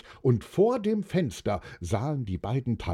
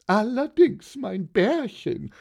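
high-pitched, doting wife voice; delighted wife; 10 semitones higher, 4 dB louder than usual. A narrator's reading. Wife answering her husband's toast, high and delighted. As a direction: coo it high at your husband.

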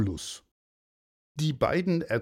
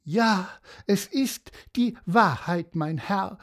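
higher, lightly voiced horse; Cassiopeia, pitiful; 7 semitones higher than usual. A narrator's reading. Horse assuring the commissioner, mildly plaintive. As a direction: insist with a touch of woe.

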